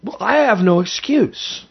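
high, drawn-out self-reproach; astonished realisation. Retold or imitated. Host recalling what he thought as a young man, astonished. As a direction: pitch it high and slow, as a dawning thought. Voices his past realisation.

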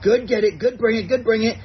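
upbeat praise to a dog, cheering it on; cheerful praise. Shown as a demonstration. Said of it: "So it'll be something like".

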